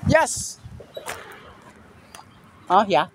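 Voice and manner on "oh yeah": usual speaking voice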